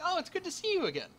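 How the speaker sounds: high, quick friendly greeting. Acted. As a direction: greet them like a welcome old friend. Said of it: "he's quite friendly to you".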